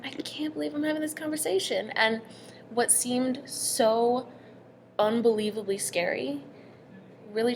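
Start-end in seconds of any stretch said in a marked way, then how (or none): 0.0-1.9 high, held-back incredulous thought; retold quiet astonishment; amazed disbelief. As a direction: say it higher, as a stunned private thought.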